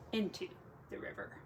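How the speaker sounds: plain reading voice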